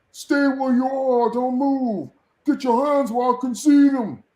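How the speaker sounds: loud, slow barked police orders; trooper commands. Retold or imitated. Trooper ordering Tim Toole to freeze, loud commands. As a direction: bark orders at the suspect.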